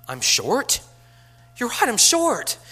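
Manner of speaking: loud, high, exaggerated disbelief; mock astonishment. Teacher playing along with teasing basketball boys, mock astonishment. Acting out his reply to teasing students.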